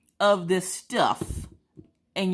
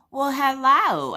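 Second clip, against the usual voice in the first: high, loud, drawn-out hello; exaggerated friendly welcome; chirpy greeter; 9 semitones higher, 5 dB louder, slower than usual. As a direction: greet with a big slow flourish. Voicing the little technician's greeting.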